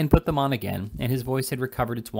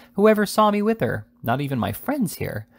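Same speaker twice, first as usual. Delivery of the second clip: loud, high, indignant challenge; vehement; 7 semitones higher, 4 dB louder than usual. A narrator's reading. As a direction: hurl it back at them, outraged. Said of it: "with great vehemence".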